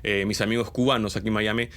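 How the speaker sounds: ordinary delivery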